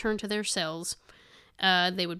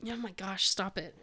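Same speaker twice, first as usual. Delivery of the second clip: indigestion; 7 dB quieter than usual. Real, not acted. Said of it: "That steak nacho Hot Pocket's really getting me now".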